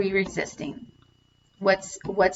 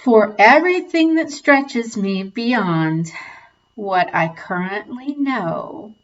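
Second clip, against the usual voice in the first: guided tapping script. Acted. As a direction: read each affirmation like a gentle mantra.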